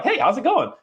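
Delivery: light, high friendly dog greeting. Retold or imitated. Trainer voicing his calm dog greeting a stranger dog, high friendly voice.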